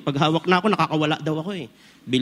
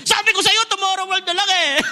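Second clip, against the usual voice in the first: shouting in the cinema. Retold or imitated. Husband yelling at his wife in a scary movie, high panicked shout. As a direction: yell it out as if the scare just hit you. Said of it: "sumigaw ako".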